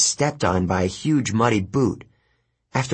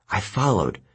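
ordinary delivery, nothing marked

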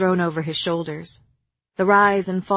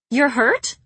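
higher, louder worried woman; Moore's voice; 6 semitones higher, 5 dB louder than usual. Acted. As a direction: ask sharply, concerned. Moore asks with concern.

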